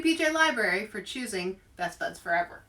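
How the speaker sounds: ordinary delivery, nothing marked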